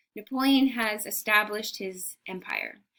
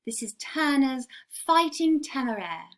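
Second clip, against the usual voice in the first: English accent; 5 semitones higher than usual. Shown as a demonstration. Teacher gives the painting's name in an English accent. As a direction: say the title like a proper English gallery guide. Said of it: "English accent".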